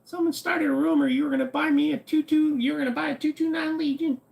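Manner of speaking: put-on high squeaky little kid voice. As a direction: play a whiny little kid.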